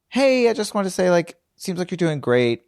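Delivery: high, sing-song imitation of a fake-nice message; sugary mock friendliness. Mocking the old bully's sweet Facebook note, high and chirpy. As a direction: parody her chirpy compliment.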